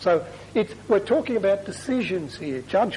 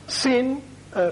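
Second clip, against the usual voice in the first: ordinary talk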